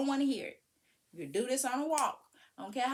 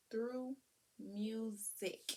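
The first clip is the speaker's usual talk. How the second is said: soft repeat; 7 dB quieter, much slower than usual. Shown as a demonstration. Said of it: "that's how I taught him".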